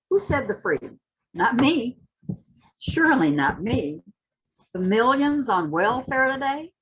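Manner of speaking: rising, impassioned spoken-word reading; dramatic poetry reading with swelling intensity; poem recitation. Acted. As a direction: recite it like a rallying cry, building toward the end. Recites Hughes poem after introduction.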